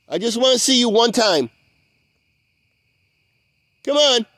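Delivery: high, loud calls into the dark, pleading; loud coaxing call. Real, not acted. Coaxing an unseen creature out of the swamp, loud and pleading. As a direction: coax him out, calling loudly. Calling to hidden creature Kyle.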